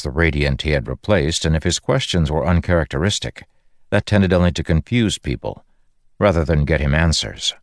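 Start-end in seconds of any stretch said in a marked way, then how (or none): none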